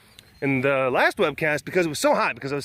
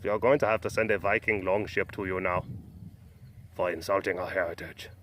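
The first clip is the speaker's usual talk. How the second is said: lightly voiced, low Norwegian viewer; mock Norwegian subscriber; 8 semitones lower, 7 dB quieter than usual. Norwegian subscriber threatening a Viking longship, mock-solemn low voice. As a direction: drop low and soft as an offended Norwegian. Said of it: "probably two Norwegian subscribers".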